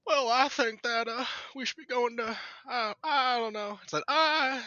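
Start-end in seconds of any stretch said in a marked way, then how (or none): 0.0-3.8 higher, slow, drawn-out Arkansas drawl; playful mock Arkansas accent with heavy emphasis on the I's. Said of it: "I've been working on my Arkansas accent". 4.1-4.6 put-on Arkansas accent. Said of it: "I've been working on my Arkansas accent".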